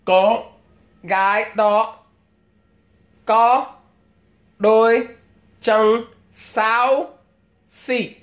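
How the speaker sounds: giọng thợ làm móng nói tiếng Việt; pedicure salon Vietnamese. Retold or imitated. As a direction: mimic a nail tech gossiping in Vietnamese. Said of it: "this is what you might hear".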